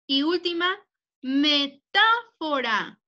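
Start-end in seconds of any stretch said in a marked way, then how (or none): 1.2-3.0 "metáfora" drawn out slowly, part by part; slow dictation. Shown as a demonstration. Teacher dictating the last word to pupils, slow dictation.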